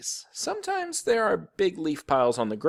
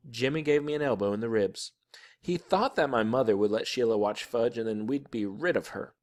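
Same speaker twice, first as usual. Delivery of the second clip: ordinary delivery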